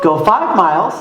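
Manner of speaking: ordinary delivery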